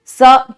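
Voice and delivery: स drawn out slowly with the tongue at the teeth; dental s demo. Shown as a demonstration. Teacher showing students where स is made, slow. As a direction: hold the स hiss slowly, right at the teeth. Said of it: "ये स एकदम front से आता है".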